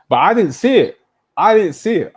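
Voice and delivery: high, loud confession; emphatic loud admission. Real, not acted. Fan loudly confessing he misjudged Rafinha, high and loud. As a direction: throw your hands up and shout the admission.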